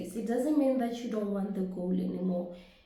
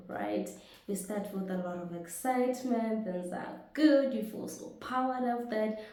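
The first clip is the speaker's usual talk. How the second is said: ordinary talk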